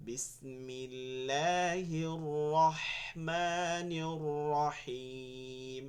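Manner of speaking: slow, drawn-out measured recitation; Quranic recitation. Shown as a demonstration. Teacher recites to show the two ha sounds, slow recitation. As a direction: recite with long, evenly held vowels.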